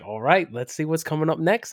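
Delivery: lightly botched Australian, drifting toward Scottish; a hint of bad Australian accent; attempted Australian accent. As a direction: imitate an Australian presenter, badly. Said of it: "that was more Scottish there".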